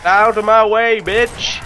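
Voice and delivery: forceful shout. Real, not acted. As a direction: shout your way through the crowd. Shoving through zombies in game.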